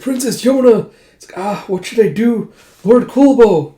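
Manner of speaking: high, loud cries of alarm; panicked cry. Acted. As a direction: cry out helplessly for rescue. Character panics as princess is grabbed.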